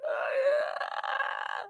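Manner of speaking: high whispered gasp of fright; startled gasp. Retold or imitated. Player ambushed by addict with pipe, high breathy gasp. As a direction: gasp as if jumped from behind. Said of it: "and you're like".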